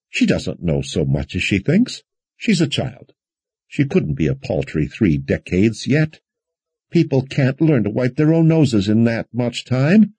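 high, fussing, worried prattle; prattling. A narrator's reading. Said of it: "Alessandra was prattling".

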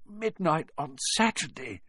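hoarse. Acted. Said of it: "he said in a hoarse voice".

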